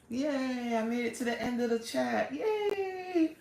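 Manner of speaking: high-pitched cheer without added volume; little celebration. Real, not acted. Host reaching the end of the chat, high little cheer. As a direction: stretch each yay in small-voiced triumph.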